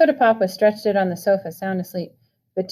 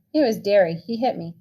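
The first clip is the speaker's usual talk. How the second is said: ordinary delivery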